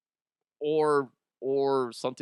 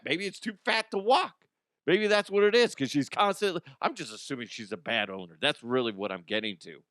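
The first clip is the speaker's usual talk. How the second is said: ordinary talk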